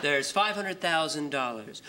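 ordinary delivery

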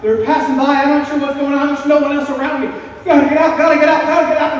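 high, loud panicked outburst; mounting panic. Retold or imitated. Teenager fleeing church as cars pass, loud rising panic. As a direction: build to a frantic repeated cry. Acts panicked flight along busy highway.

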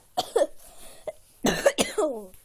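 big hacking mock cough; loud acted retching cough. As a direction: cough it up loudly, hammed-up. Acted sick puppet, then apologises.

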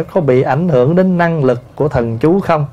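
ordinary delivery

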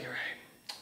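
breathy, barely there whisper; whispered surrender; defeated whisper. Acted. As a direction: whisper it like giving up. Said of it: "He's like".

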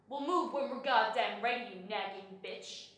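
loud, irritated comeback; loud and dismissive; gruff retort. Acted. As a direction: snap it back as an insult. Imagined rude reply from shopper.

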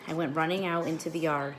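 usual speaking voice

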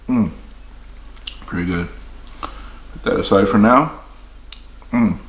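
speaking with mouth full; mumbled through food; while chewing. Real, not acted. Seen 3: Holds bitten donut, mouth closed and working as if chewing, licks fingers.

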